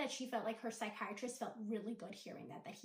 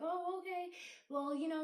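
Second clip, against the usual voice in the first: higher, surprised "oh, okay" as the retold therapist; a caught-off-guard therapist, pitch jumping up; taken aback; 7 semitones higher, 4 dB louder than usual. Therapist hearing it's the last session, higher and surprised.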